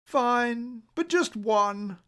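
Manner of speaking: high, bored yawning reluctance. Acted. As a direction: say it through a yawn. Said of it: "with a yawn".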